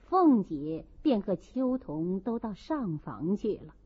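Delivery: plain reading voice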